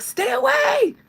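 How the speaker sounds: very high, full-blast shout; horrified fans yelling. Acted. Fans warning the singer off recording, frantic high shout. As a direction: scream a warning as panicked fans. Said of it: "your fans will be like".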